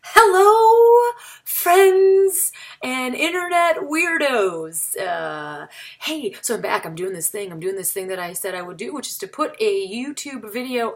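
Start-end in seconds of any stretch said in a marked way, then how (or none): 0.0-5.0 big loud hello. Real, not acted. Opening greeting to viewers.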